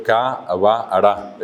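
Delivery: cabara slowly, an A between B and R; vocal en medio. Shown as a demonstration. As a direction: open cabra up into ca-ba-ra. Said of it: "se mete una vocal en el medio". (cabara, con una A entre B y R)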